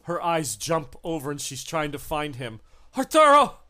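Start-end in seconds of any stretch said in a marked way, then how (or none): 3.0-3.6 very high, loud cry for Arturo; frantic shout. Acted. The creature screams for her captor, high and loud. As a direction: scream his name in panic.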